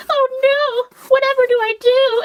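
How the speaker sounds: very high falsetto damsel voice; hammed-up squeaky distress; mock helpless nurses. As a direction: imitate flustered nurses way up high. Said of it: "filming all the nurses".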